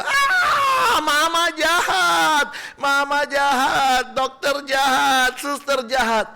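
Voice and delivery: put-on very high, loud slow crying child's wail; child wailing at mom, doctor and nurse after the shot, high and slow. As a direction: bawl it like a toddler who just got a shot.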